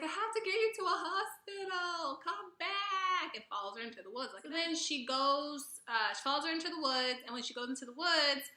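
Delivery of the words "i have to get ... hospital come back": calling after her. Retold or imitated. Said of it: "is like".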